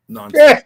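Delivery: nonsense shot up high and loud; scornful nonsense. Real, not acted. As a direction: spit out the word nonsense. Mocking her Facebook posts.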